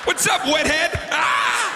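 hammed-up schoolyard heckle; mocking taunt. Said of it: "people are gonna be like".

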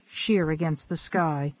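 ordinary delivery

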